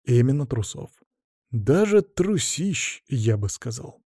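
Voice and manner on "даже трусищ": трусищ drawn out slowly and pitched high; stress on трусищ. A narrator's reading. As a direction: stretch трусищ to make it huge. Narrator jokingly magnifies the word.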